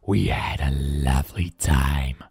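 deep contented monster; smiling Blob. Acted. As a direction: drop low and slow, pleased. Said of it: "smiled Blob".

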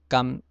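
甘 as "kam", closing on m; Hokkien reading. Shown as a demonstration. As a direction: say 甘 in Hokkien with lips shut at the end. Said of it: "省份从第一个".

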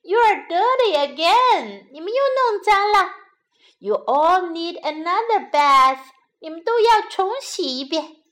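dismayed mother bunny, voice up; scolding mother. A narrator's reading. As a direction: scold the little ones loudly. Mother scolds the dirty bunnies.

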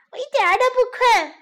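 high, loud fast little protest; child protesting. Acted. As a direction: insist like a wide-awake toddler. Child insists he's not sleepy.

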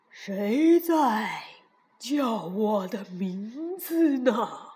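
low, slow bear voice; deep voice from above; booming voice from sky. A narrator's reading. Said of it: "很低沉的声音".

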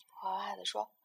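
ordinary delivery

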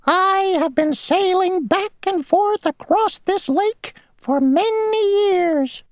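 very high, tiny, self-important insect voice; a little insect bragging; boastful mosquito. Acted. Mosquito boasting to Grasshopper mid-puddle, tiny high voice.

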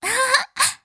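high light amused chuckle; bee's laugh. Acted. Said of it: "蜜蜂说".

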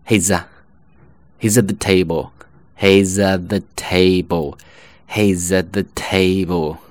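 "at" reduced to uh before "the"; slow, linked phrase with the t of "at" dropped; weak form. Shown as a demonstration. Teacher drilling he's at the table, slowed-down weak "at". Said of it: "读为呃".

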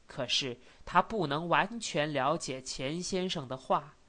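usual speaking voice

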